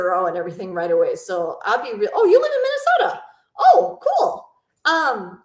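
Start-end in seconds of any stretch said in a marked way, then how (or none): 2.1-4.5 high, loud excited exclamation; delighted surprise. Real, not acted. Streamer learning viewer lives in Minnesota, delighted surprise. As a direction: burst out with it, pitched way up.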